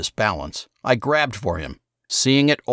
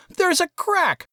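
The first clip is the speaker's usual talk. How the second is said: Saul, outraged. A narrator's reading. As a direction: wail it like a boy whose guitar just cracked. Saul sees guitar crack.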